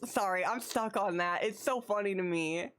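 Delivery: ordinary talk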